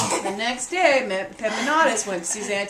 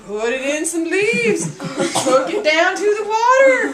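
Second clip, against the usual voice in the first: laughing singsong; 4 semitones higher, 6 dB louder than usual. A narrator's reading. Narrator laughing, singsong repeats.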